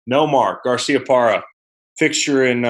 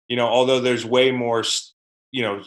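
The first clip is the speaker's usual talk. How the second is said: ordinary talk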